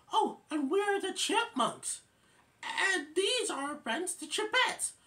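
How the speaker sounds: high-pitched, excited chipmunk introduction; very high, eager little voice; Theodore. Retold or imitated. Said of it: "And then Theodore says".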